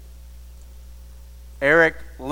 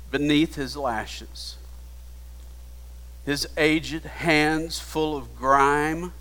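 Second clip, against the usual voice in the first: ordinary talk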